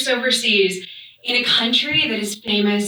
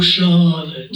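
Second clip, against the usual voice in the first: put-on grand R-less old-timey accent on the name; 6 semitones lower, 3 dB louder than usual. Everybody pales before her, grand old-timey accent. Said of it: "has an old-timey accent".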